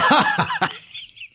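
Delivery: put-on drunken high giggle; a silly squeaky giggle. Acting Kat, high giggle.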